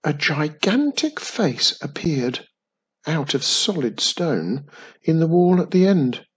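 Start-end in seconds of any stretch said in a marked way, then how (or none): none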